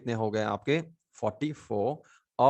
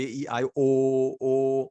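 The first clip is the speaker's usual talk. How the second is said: usual speaking voice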